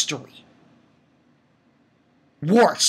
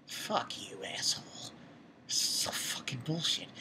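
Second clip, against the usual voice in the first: very soft, whispered swearing; hushed, seething whisper; disgusted whisper; 3 semitones lower, 18 dB quieter than usual. Retold or imitated. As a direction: hiss curses under your breath. Retold disgusted reaction, whispered.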